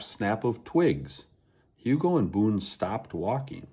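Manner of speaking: ordinary delivery, nothing marked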